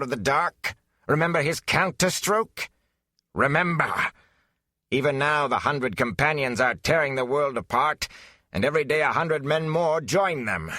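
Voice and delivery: high, gloating villain voice, pressing each remember; taunting villain. Acted. Betrayer taunting the hero with his crimes, gloating. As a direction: twist the knife with every line.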